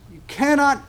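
usual speaking voice

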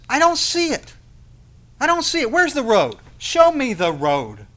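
high, loud, insistent complaint; exasperated Thomas. Acted. Thomas coming before God unable to see, loud and exasperated. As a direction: cry it out high, slowing on the last demand.

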